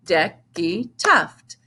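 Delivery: slow, each syllable separated; measured, beat-by-beat name. Shown as a demonstration. Teacher putting a child's whole name together, slowly by syllables.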